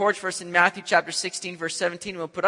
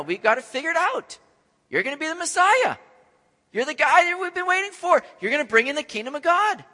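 rebuking Peter; 7 semitones higher than usual. Retold or imitated. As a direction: scold Jesus in disbelief. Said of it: "he begins to rebuke him".

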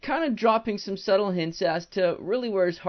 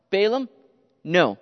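normal talking voice

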